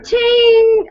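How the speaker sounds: high loud cash register call; excited cash register; cha-ching. Retold or imitated. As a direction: ring it out like a till. Sale sound effect.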